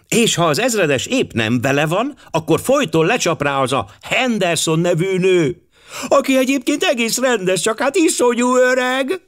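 put-on high girlish chatter climbing to a squeal; giggly girls. A narrator's reading. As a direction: rattle it off in an excited high voice. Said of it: "Vihogva kirontottak". (magas, vihogós lányhang)

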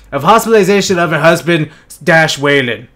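ordinary delivery

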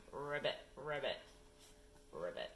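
low froggy ribbits; baby frog. Acted. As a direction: croak like a little frog. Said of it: "the baby frog says".

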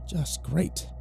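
soft muttered complaint; mumbled. Acted. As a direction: mutter it under your breath. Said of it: "I mumbled".

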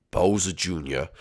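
low, soft call; ghostly father. Acted. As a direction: call gently from beyond as Dad.

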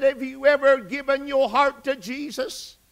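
high, insistent retold question; pressing question. Aunt returning to her question to her nephew, pressing.

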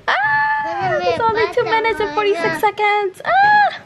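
high excited shriek; excited squeal. Real, not acted. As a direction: squeal it, almost midnight. Excited squeal near midnight.

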